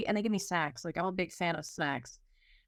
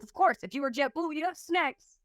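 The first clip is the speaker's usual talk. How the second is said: higher, snarky jab at the airline; mock the missing snacks, pitched up; sarcastic aside; 6 semitones higher than usual. Passenger griping to herself about no snacks, snarky and high. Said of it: "And I'm like".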